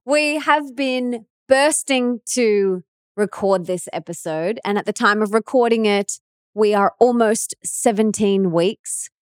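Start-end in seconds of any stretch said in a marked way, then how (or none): 0.0-3.7 excited. Real, not acted. Just after the big reveal.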